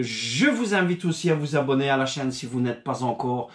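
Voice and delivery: ordinary delivery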